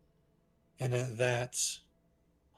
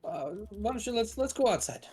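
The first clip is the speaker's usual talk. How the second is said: much higher, lilting in-character voice; shopkeeper character voice; 10 semitones higher, faster than usual. Acted. Shopkeeper suggesting the group go outside, high character voice. Game master plays shopkeeper.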